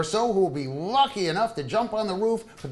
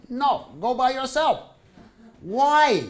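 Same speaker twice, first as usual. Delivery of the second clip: insistent; 6 semitones higher, 5 dB louder than usual. Retold or imitated. Wife insisting, husband asks why.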